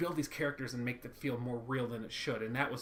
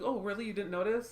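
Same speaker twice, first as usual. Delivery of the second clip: higher, hopeful little lift; hopeful tone shift; 7 semitones higher than usual. Retold or imitated. Louise pleased Tina didn't notice, hopeful higher tone. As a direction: brighten up like she's relieved. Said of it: "she changes the tone".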